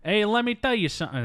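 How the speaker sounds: high, brash New York tough guy; New York accent. Retold or imitated. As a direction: lean into a New York wiseguy. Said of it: "That's, that's New York".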